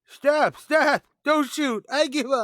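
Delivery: high, loud panicked yelling; panicked surrender. Acted. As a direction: scream it like a cornered man begging for his life. Cornered suspect surrenders in panic.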